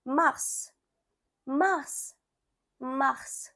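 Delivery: mars as French "mahrs", s sounded; French pronunciation. Shown as a demonstration. Teacher showing learners March in French, careful French mars. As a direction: repeat mars softly, one at a time. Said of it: "You can say".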